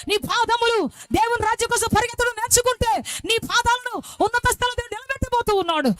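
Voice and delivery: high, loud pulpit preaching; rising sermon fervour; full-force preaching. Acted. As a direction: preach it out to the hall. Preaching climax.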